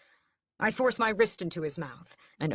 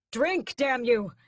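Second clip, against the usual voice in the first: slow, higher, louder desperate scream; 7 semitones higher, 6 dB louder, slower than usual. A narrator's reading. Brother screaming at dying Raoul to drink, desperate. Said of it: "I scream".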